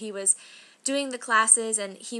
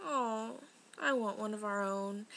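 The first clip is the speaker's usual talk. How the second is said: wistful; 8 dB quieter than usual. Retold or imitated. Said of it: "be all like".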